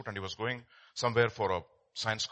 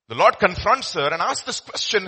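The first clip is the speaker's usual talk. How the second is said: ordinary delivery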